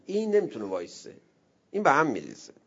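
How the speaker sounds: usual speaking voice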